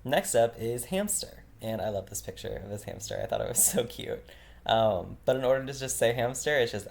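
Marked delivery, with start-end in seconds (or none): none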